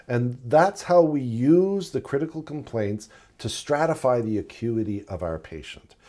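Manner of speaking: usual speaking voice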